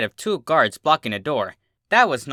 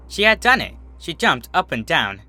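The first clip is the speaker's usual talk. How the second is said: ordinary delivery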